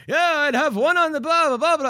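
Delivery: high, hammed-up Irish accent; a quick jokey Irish brogue for laughs. Ribbing a fighter's crude tweet in an Irish accent. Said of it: "say what he said in a Irish accent".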